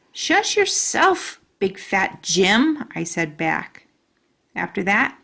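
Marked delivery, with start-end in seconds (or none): 0.1-2.9 sassy comeback. Acted. Child snapping back at boy.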